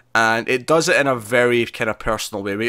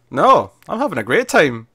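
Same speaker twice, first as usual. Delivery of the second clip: mock bright defiance, higher and louder; defiant cheer; 5 semitones higher, 5 dB louder than usual. June brushing off Aunt Lydia, mock defiant cheer. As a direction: chirp it back with defiant cheer. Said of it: "And she's like".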